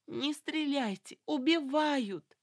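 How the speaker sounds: high, slow drawn-out wail. A narrator's reading. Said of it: "громче прежнего завопила Люсьен". (отчаянный протяжный вопль)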